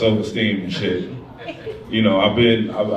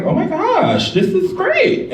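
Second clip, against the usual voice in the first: imitating Sarah; much slower than usual. Comedian imitating his date Sarah picking a restaurant, high and gushing. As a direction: squeal it like a delighted date. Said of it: "that's how she sounds, y'all".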